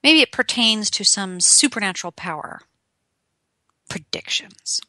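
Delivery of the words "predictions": soft, mystical whisper; hushed, eerie whisper; spooky whisper. Pretend. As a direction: breathe it like a fortune teller. Mocking mystical psychic image.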